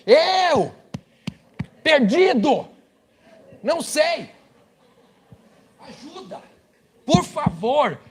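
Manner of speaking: a lost Brazilian shouting for help in high mock panic; panicked yelling tourist. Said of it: "ele começa a gritar".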